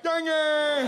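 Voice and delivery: dentist-numbed greeting shout; numb-mouthed shout. Retold or imitated. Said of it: "I'm like".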